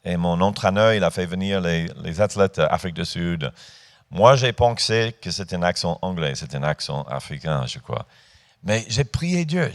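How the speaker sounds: normal talking voice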